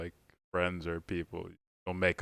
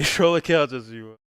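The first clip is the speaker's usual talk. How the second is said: laughing; 6 semitones higher, 11 dB louder than usual. Real, not acted. Laughing through the line.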